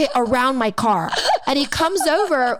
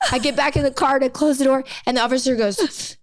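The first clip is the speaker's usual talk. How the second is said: normal talking voice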